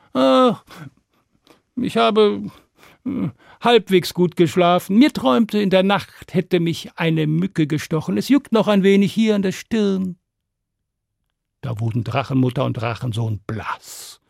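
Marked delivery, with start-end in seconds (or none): 0.1-10.2 high, breezy boasting voice; cheeky Stan. Acted. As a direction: brag lightly as if it were nothing.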